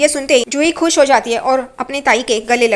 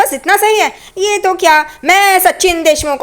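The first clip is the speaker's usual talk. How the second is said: filmy declamation; 7 semitones higher, 3 dB louder than usual. Retold or imitated. Sachin shows off to the film crew, grandly declaiming. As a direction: announce yourself like a film hero.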